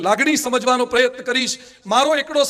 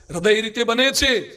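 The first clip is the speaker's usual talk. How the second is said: usual speaking voice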